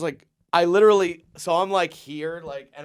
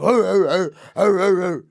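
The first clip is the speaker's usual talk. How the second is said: loud, high imitation animal barks; young animal growls; 5 semitones higher, 8 dB louder than usual. Young men fighting like animals, loud mock growls. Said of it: "like these young animals being like".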